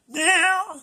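playful high-pitched meow; imitated meow. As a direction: mimic the little cat's cry. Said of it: "Look at that".

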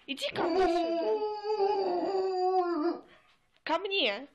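high, slow nagging call; shrill nagging grandma. Acted. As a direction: drag it out like a scolding granny. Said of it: "calling the dog".

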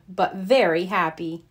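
ordinary delivery

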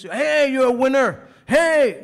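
high, very loud mock pep shout; hyped-up cheering. Preacher mimicking praise he could say to you, hyped-up cheering. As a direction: belt it out like an over-the-top cheerleader.

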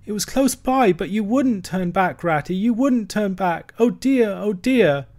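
a small creature weeping for his lost home; sobbing lament. A narrator's reading. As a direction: plead through sobs, voice climbing. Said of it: "he sobbed forth at last, brokenly".

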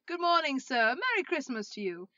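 loud, cheery street hail; big jolly salutation; hearty greeting. Acted. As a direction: call out a festive greeting. Passers-by greet Scrooge in street.

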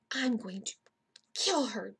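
usual speaking voice

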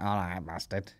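put-on soft, mumbled [name] accent greeting; lightly imitated [name] accent; mock [name] accent. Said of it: "not an, like, an Irish accent".

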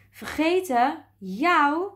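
hoog en luid ongelovig uitroepen; verontwaardigde uitroep. A narrator's reading. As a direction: roep het geschrokken, met hoge, harde stem. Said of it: "riep de eekhoorn". (verontwaardigd roepend)

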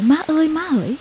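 high, drawn call imitating a bawling child; mock crying call. Baby brother wants mother at night, high mock wailing call. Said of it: "cứ quơ tay khóc thét, gọi".

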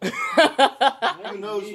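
slow, loud, laughing question; laughing while talking. Real, not acted. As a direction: talk through your laughter. Laughing right after the prank reveal.

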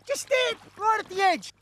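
high, urgent excited voice; excited pointing out. Acted. Castaway showing his mate the boat, excited and high. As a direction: urgently direct his eyes to it.

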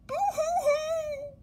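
high, loud, slow hammed-up boo-hoo cry; mock sobbing. Narrator voicing the crying boy in number seventeen, hammed-up boo-hoo sob. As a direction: wail like a crying child in a picture book. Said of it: "The crying was coming".

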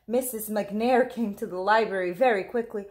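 ordinary delivery, nothing marked

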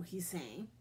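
usual speaking voice

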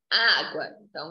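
ordinary talk, nothing marked

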